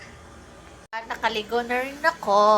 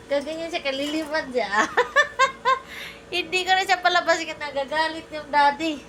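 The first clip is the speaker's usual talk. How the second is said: normal talking voice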